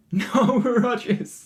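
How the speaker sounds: laughing the name out, high and slow. Real, not acted. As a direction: giggle through the name slowly.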